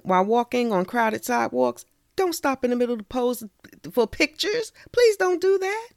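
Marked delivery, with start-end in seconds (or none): 2.1-5.9 high, emphatic plea; exasperated "please don't do that"; pleading. Real, not acted. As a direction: beg them to stop, rising. Pleading with tourists.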